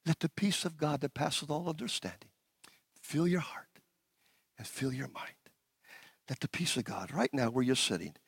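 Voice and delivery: low, hushed prayer, sinking to a whisper; soft prayer. Acted. Pastor praying peace over the congregation, hushed and low. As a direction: let it settle over them, hushed.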